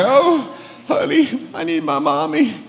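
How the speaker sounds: whiny sick man. Retold or imitated. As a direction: play a pathetic man begging for mothering. Mocks men with a cold.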